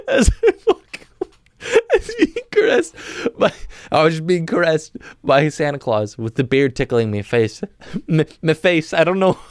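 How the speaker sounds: cracking up, high squeaky laugh through the words; real helpless laughter while trying to talk. Real, not acted. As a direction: try to get it out through the giggles. Cracks up at own narration.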